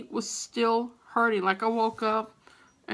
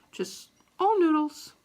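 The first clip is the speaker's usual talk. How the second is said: higher, awed delivery; amazed noodle fan; marveling; 8 semitones higher than usual. Retold or imitated. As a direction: sell the wonder of it.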